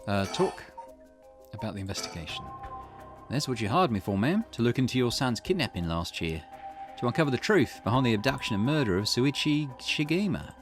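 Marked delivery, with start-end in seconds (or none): 3.3-10.5 lightly voiced investigator addressing a client; Richter. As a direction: recap the job to ma'am, lightly in character.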